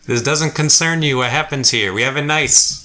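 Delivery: acted guard calling down; dismissive guard. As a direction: warn the strangers off in character. Guard rebuffs the party.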